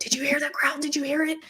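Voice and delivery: high, hushed-panic retelling; high, pressing questions; urgent questioning. Retold or imitated. Frightened daughter urging her mother.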